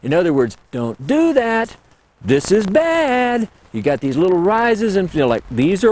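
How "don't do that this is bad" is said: high, slow, short warning tone; alert sound. Shown as a demonstration. Showing callers how the alert sound goes, high and warning. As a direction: say it like a sharp warning. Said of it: "real short and they're fairly loud".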